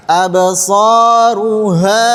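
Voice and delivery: "absaruha" slow with a hard, rough ha; wrong ha. Shown as a demonstration. Teacher models the mistake for students, slow. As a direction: drag "absaruha" out, hitting the ha wrong. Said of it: "Jadi jangan".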